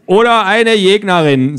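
höher, betont korrigierend; Hertha. A narrator's reading. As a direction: Hertha berichtigt spitz. (spitze Korrektur von Hertha)

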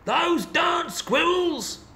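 put-on high, slow, cranky old man shout; old man yelling. A narrator's reading. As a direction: shake your fist and yell it like a grumpy old man. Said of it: "shook his old man fist and yelled".

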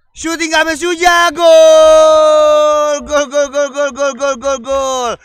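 big goal shout fading into chant; goal call. Real, not acted. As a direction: yell gol, then chant it fast. Commentator calls a goal. (teriakan gol)

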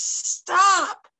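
high, loud howling shout; wolf howling to stop the herd; howled. Acted. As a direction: bellow it to halt the stampede. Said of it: "howled at the top of his lungs".

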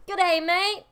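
loud, high Australian greeting; attempted Australian accent. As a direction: burst out in a mock Australian accent. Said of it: "I did the wrong accent".

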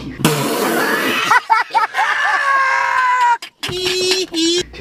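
very high, loud shrieking laugh; shrieking laughter. Real, not acted. Friend cracks up over teasing about asking his mom, shrieking laughter.

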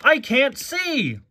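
high, drawn-out yell; hammed-up cry of alarm; yelling McQueen. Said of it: "yelled McQueen".

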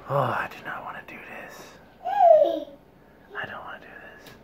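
whispered dread. Real, not acted. Dreading the hot wing.

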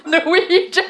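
high laugh through the word. Real, not acted. Laughing at forgetting her own language. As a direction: laugh at yourself.

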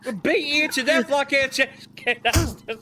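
loud furious yell at a teammate; angry shout. Real, not acted. Player yelling at the teammate who shot him, furious shout. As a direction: bellow it, outraged at being shot.

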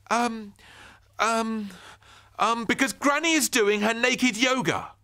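put-on high, loud stammering boy; stall, then blurt the excuse. Ben inventing an excuse for the neighbour, high and stammering.

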